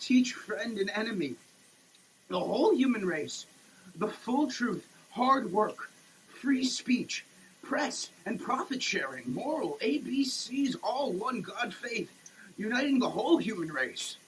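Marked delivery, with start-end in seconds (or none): none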